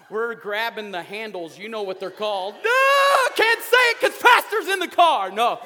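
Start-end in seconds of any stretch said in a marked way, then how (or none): 2.5-5.6 playful outburst. Real, not acted. Joking about the forbidden word.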